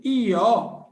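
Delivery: drawn-out I O sounds; slow teacher demonstration of the vowels; slow vowel demo. Shown as a demonstration. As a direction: stretch each vowel slowly. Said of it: "Quando você fala prêmio".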